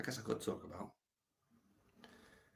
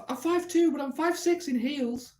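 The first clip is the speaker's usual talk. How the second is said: high put-on woman's voice, louder; woman in a nightclub; 4 dB louder than usual. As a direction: squeak it out in a high, mock-girly voice. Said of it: "She goes".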